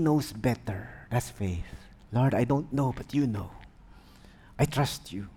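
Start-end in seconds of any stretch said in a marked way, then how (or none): 2.1-5.3 lower, soft, sincere; soft trusting prayer; humble prayer. Retold or imitated. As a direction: surrender quietly in prayer.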